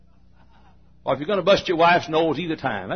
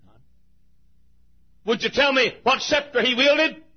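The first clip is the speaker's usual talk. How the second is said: ordinary delivery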